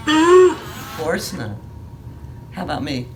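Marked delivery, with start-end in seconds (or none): none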